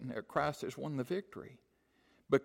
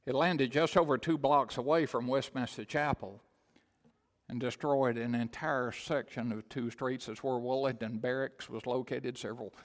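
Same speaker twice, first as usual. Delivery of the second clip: ordinary delivery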